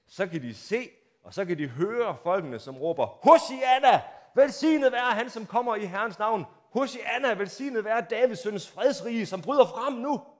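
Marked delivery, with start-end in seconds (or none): 3.1-10.4 loud, high, jubilant Hosanna cries; acting out the cheering Jerusalem crowd, joyful shouting. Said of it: "Jeg ved godt, jeg står og råber lidt".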